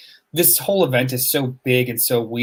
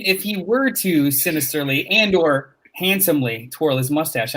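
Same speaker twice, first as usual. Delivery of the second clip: ordinary talk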